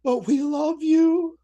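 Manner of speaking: high, slow sweet plea; pleading boom boxes. Acted. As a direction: coo it up high and slowly. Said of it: "boom boxes said".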